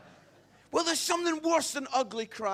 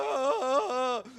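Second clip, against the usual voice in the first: mock sobbing; 4 semitones higher, 5 dB louder than usual. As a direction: keep bawling helplessly, hammed-up. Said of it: "He is sobbing".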